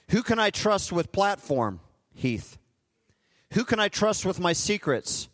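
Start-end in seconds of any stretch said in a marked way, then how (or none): 2.1-2.6 soft answer. Acted. God answers softly by name.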